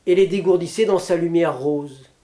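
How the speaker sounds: usual speaking voice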